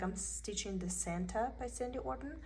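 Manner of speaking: ordinary talk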